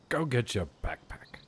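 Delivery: a hint of a dad hurrying a kid off to school; mock British dad. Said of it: "I really enjoy the way he says".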